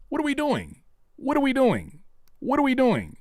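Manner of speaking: high falling what are we doing; exasperated outcry. Real, not acted. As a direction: throw your hands up with it.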